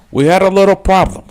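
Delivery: high, loud gangster caricature; hammed-up movie gangster on the phone; Tony from Scarface. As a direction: push it high and loud, mock-casual. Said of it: "funny about Scarface".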